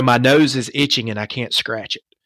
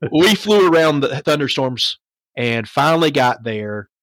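normal talking voice

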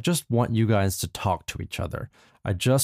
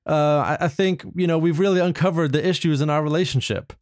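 teary reply; 7 semitones higher than usual. Acted. Tearful reconciliation reply.

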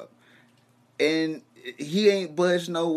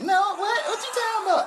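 very high squeaky woman voice; high falsetto what; confused girlfriend. Retold or imitated. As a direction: play her baffled.

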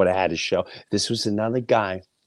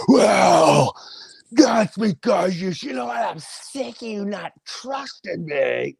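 louder, higher, angry burst; retold shout; 10 semitones higher, 3 dB louder, slower than usual. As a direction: yell back at the daughter.

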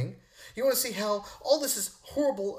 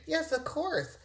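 gullible idiot's higher-pitched answer; 8 semitones higher, 3 dB quieter, slower than usual. Pretend. Host mocking an idiot's answer, gullible and high. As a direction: play a clueless agreer. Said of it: "if you're an idiot, I can hear you saying".

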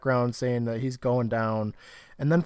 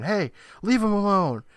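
higher, mildly raised mock yell; retold scream; 6 semitones higher, 4 dB louder than usual. Recapping Jamie screaming at the hooded figures, mock yell. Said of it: "And Jamie's screaming".

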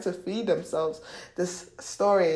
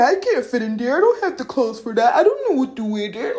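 higher, louder mimicking; hammed-up complaining; mock whiny excuses; 5 semitones higher, 7 dB louder than usual. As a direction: reel off excuses in mockery. Mimics listener's excuses, then shut up.

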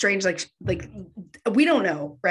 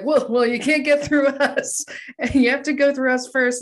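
cocky; 6 semitones higher than usual. Retold or imitated. Heroes confronting Gozer.

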